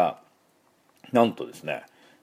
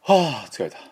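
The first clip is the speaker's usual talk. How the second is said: demonstrated weary sigh, raised in pitch; tired sigh; 5 semitones higher than usual. Shown as a demonstration. Teacher showing viewers the breath sound, tired sigh. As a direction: breathe out "はぁ" from the back of the throat. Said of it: "ちょっと疲れた時に".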